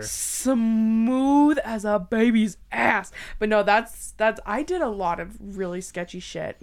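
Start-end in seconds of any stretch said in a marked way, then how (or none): 0.0-3.1 slow, louder comic boast; slow hammed-up brag; slow playful drawl. Real, not acted. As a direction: savor it slowly like a punchline. Joking reply to teasing.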